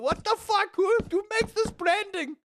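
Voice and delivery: squealed incredulous outrage; high-pitched disbelief. Retold or imitated. Streamer reacting to branding, high incredulous squeal. Acts out own disbelief at slogan.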